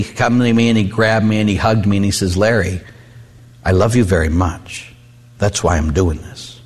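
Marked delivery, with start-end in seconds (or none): 2.4-6.6 low, unhurried fatherly sincerity; retold loving word from a dad; tender reassurance. Father hugs him after discipline.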